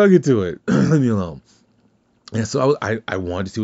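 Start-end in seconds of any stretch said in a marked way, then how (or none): 0.0-1.4 louder, higher "I'll get to it", pushed out firmly; annoyed louder retort. Retold or imitated. Son telling his mom to leave him alone, louder. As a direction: snap back "I'll get to it" to end the talk. Second, firmer brush-off of mom.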